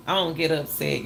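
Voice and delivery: usual speaking voice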